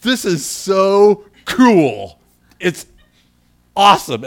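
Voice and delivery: loud, slow, drawn-out delight; thrilled. Retold or imitated. Pastor reenacting his joy to his puzzled wife, loud and slow. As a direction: savor every word, beaming. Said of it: "I was like".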